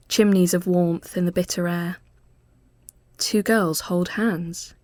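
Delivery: usual speaking voice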